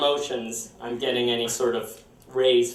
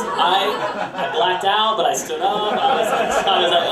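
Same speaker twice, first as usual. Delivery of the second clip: normal talking voice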